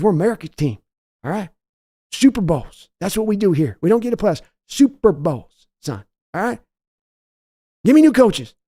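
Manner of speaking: a hint of the owner's high voice; father scolding son over the loss, lightly voiced; Jerry Jones scolding. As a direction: needle the son about the loss. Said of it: "your Jerry Jones voice yelling at Steven".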